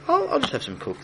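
high request. Acted. King orders.

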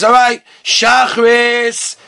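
lightly voiced wake-up call, slowly drawn out; Mashgiach's wake-up call. Mashgiach rousing a student for morning prayers, drawn-out wake-up call. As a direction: stretch it out to rouse a sleeper. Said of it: "the Mashgiach comes over and says".

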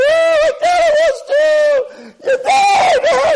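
extremely high, loud grief-stricken mock shriek; hysterical screaming. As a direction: scream it as the twin going crazy. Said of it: "He starts screaming".

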